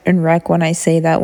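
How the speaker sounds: ordinary talk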